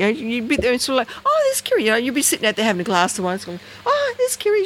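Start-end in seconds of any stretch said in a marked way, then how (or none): 1.2-1.8 put-on high, sing-song baby talk to a bird; cooing at a pet. To her pet bird on the shoulder, high delighted cooing. 3.8-4.6 put-on high, sing-song baby talk to a bird; cooing at a pet. To her pet bird on the shoulder, high delighted cooing.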